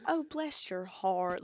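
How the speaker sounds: slow, sugary Southern drawl; sweet Southern mom. Retold or imitated. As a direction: put on Southern-belle sympathy. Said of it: "zero to a hundred with Southern moms".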